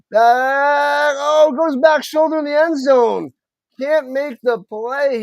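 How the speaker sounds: high, loud call of an end-zone throw; excited play-by-play. Real, not acted. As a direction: raise the voice as the chance goes begging. Calls a pass to end zone.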